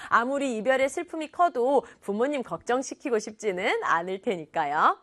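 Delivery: normal talking voice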